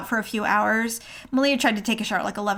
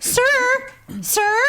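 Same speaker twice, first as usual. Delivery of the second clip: retold cast member; 14 semitones higher, 4 dB louder than usual. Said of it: "the cast member's like".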